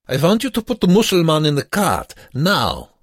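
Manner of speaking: put-on high, loud, rapid command with an accent; angry guard's order. A narrator's reading. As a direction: shout it as a brutal camp guard. Guard orders and kicks prisoner.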